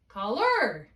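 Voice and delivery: "caller" as call-ER, ER punched loud; light call, heavy jump onto ER; stress on second syllable. Shown as a demonstration. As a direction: lean hard on the last syllable of caller. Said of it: "If I put an accent here".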